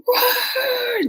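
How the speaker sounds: high-pitched engine roar; imitated car roar. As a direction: mimic a car speeding past. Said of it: "the speeding of a car".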